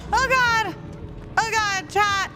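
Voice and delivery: high-pitched cries of doom; panicked wailing. Real, not acted. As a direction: wail to your audience in panic. Doomed in game chase.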